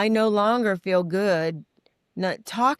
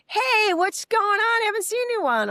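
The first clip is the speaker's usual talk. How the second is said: friend calling from car; 4 dB louder than usual. Retold or imitated. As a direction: shout a cheery hello from a car window.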